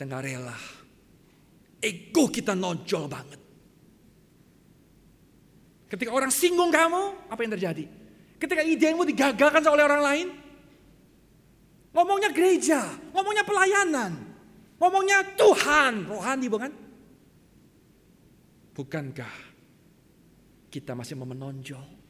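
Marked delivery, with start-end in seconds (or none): 5.9-16.7 loud, high preacher's cry; full-force preaching. Real, not acted. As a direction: preach it out forcefully at the top of your range. (khotbah lantang berapi-api)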